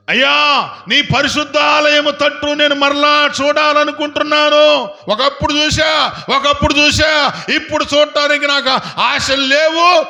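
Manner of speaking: high, loud pleading shout; Jonah's desperate cry. Retold or imitated. Jonah crying to God from the pit, loud pleading shout. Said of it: "యోనా అంటాడు".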